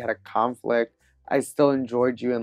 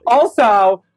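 giggling through the words; laughing; 9 semitones higher, 7 dB louder than usual. Real, not acted. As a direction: laugh while confessing. Laughing confession.

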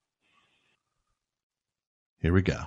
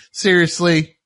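mildly incredulous scolding, pitched up; 5 semitones higher than usual. Acted. Singer stopping the show to chide fans, mildly incredulous. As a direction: question it in mock disbelief. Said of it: "stop the show at one point and go like".

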